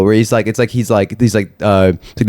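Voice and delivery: normal talking voice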